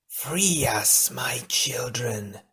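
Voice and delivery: slow, whispery echoing creature voice; joyful wail. A narrator's reading. Imprisoned queen calls to her children from below, wailing joyfully. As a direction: wail it out in a drawn-out eerie breath. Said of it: "it wailed joyfully".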